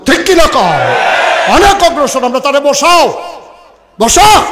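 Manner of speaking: high, loud preaching cry; fiery shout. Real, not acted. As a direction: rouse the crowd hard. Fiery preaching climax to crowd.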